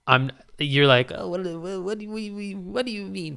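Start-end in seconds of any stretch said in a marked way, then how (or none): 1.1-3.4 mock baffled listener. Mocking baffled non-users.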